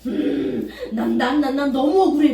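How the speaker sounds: loud stuttering sulky complaint; mock grievance. Believer clutching anger, loud sulky whine. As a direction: whine that it's unfair.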